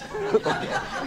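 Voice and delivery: a short unvoiced laugh; breathy laugh. Real, not acted. Laughing about the mortgage advert small print, breathy laugh. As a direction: laugh under the breath at the joke.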